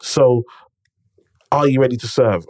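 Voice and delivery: ordinary talk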